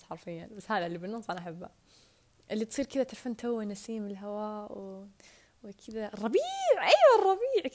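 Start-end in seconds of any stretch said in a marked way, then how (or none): 6.1-7.7 much higher and louder, triumphant; excited recall. Real, not acted. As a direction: shout it out on remembering. Finally remembers the word spring.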